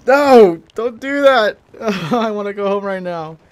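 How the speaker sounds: high, loud panicked cry, slow at first; scared kid begging mom to go home; terrified. Retold or imitated. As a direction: shriek it out in total fright. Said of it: "I'm like".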